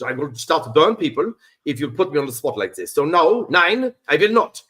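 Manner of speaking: thick German accent, indignant and emphatic. Shown as a demonstration. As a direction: put on an irritated German. Does German accent on request.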